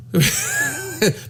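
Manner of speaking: a sudden high-pitched laugh; high cackle. Real, not acted. Comedian cackles at his own punchline, high-pitched. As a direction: let out a squealing laugh.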